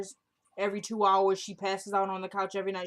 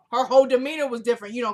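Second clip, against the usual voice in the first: usual speaking voice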